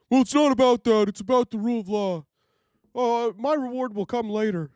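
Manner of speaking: high, smug mock conservative. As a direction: parody a pious Trump voter. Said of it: "I've yet to have a conservative answer that question".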